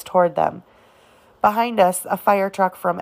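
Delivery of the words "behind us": usual speaking voice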